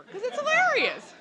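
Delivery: high, loud laugh through the words; laughing out loud, still tickled; laughing hard. Real, not acted. Telling her friends, laughing loudly. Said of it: "doubled over and braying laughter".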